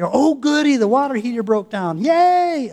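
high, loud fake delight; mock cheer. Mocking cheer at a broken water heater. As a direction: gush like it's great news. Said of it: "I'm not saying".

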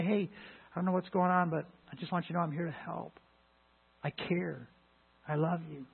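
tender reassurance. Retold or imitated. Voicing care for a crying coworker.

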